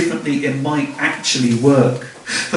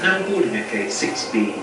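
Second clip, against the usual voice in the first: softer, even tannoy announcer read; airport announcement; 8 dB quieter than usual. Pretend. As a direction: read it like a gate call over the speakers.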